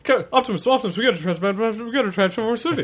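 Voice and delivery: high, loud, fast cartoon robot voice. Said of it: "that was like".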